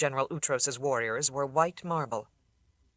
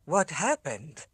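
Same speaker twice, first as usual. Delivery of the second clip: miserable repeat; slower than usual. A narrator's reading. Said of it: "utterly miserable".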